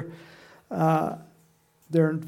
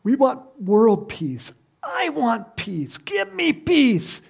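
slow, building whine, up to a much higher cry; mock plea; 8 semitones higher, 3 dB louder, slower than usual. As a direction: imitate the world begging for peace. Mimicking people crying for peace.

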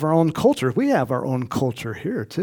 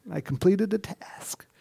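whisper; 3 semitones higher, 6 dB quieter than usual. Retold or imitated. Saul's smug claim.

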